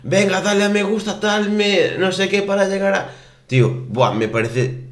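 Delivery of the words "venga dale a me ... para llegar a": much higher, pushy livestreamer begging for likes; mock the begging streamer in a high sing-song. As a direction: ape a cheesy streamer chasing likes, pitched way up. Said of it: "directos rollo".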